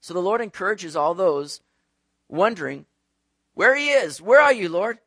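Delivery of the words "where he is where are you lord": loud, high, searching cry; acted-out plea, voice raised; pleading call. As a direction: call out as if wondering where help is. People calling out for the Lord.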